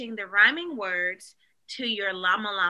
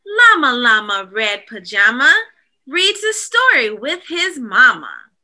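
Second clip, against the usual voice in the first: louder, sing-song picture book read; storybook reading; 10 dB louder than usual. A narrator's reading. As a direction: read it to little kids.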